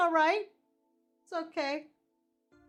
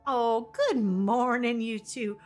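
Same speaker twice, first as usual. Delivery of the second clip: put-on warm elderly shopkeeper greeting; slow, welcoming old woman; old lady voice; 4 dB louder than usual. Shopkeeper Sybil greets them.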